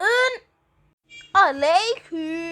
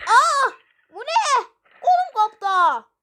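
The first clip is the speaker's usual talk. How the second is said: higher, louder shocked cry over the arm; pain scream; 8 semitones higher, 6 dB louder than usual. Acted.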